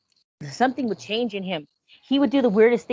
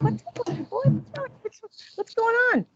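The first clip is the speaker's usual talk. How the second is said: very high alarmed calling out; aunt calling to running nephew, much higher pitch; 13 semitones higher than usual. Retold or imitated. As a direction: run out the front door demanding answers. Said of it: "I said".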